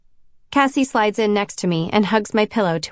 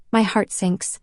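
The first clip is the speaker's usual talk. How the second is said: ordinary delivery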